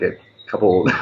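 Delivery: normal talking voice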